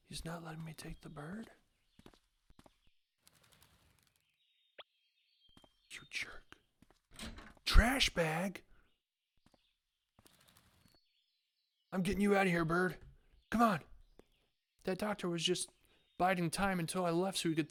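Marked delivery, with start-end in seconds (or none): 0.1-6.6 secretive whisper. Real, not acted. Gamer sneaking past the doctor, whispering. 7.6-13.8 louder, higher urgent rescue; excited. Real, not acted. Gamer rescuing the bird from the trash, urgent and excited.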